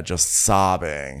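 ordinary delivery

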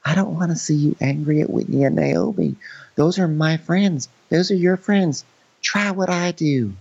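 imitated pleading girlfriend. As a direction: plead gently, drawing it out. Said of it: "And she goes".

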